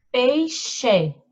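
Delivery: PEIXE read as "pei-XÊ", with a full final E; spelled-out "pei-xê"; wrong final E. Shown as a demonstration. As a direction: sound out the final E of Peixe, not an I. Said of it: "A gente lê peixe? Não".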